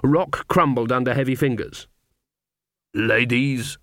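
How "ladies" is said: lightly voiced resonant greeting; a hint of a resonant character voice. A narrator's reading. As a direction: greet them with a touch of resonance. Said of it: "said a resonant voice".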